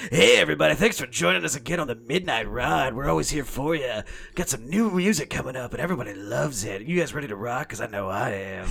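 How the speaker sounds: put-on late-night DJ, punched opening; swooping, hammed-up radio patter. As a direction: lay it on thick like an FM host. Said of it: "I was clearly doing a radio voice".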